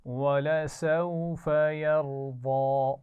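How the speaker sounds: teacher chanting Quran for students to echo; Quranic recitation. Shown as a demonstration. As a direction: model the verse slowly for the class to repeat. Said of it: "एक दफ़ा फिर पढ़ेंगे".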